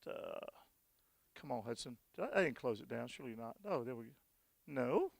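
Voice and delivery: low, quiet aside while hunting on screen; talking to himself. Real, not acted. Presenter searching the website mid-talk, talking to himself quietly.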